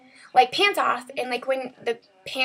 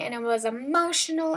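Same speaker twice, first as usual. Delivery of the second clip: normal talking voice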